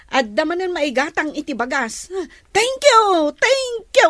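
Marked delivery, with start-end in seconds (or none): 2.5-4.1 high, loud gushing thank you. Acted. Worker gushes thanks to the boss. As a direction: overflow with gratitude. Worker overjoyed at pay news.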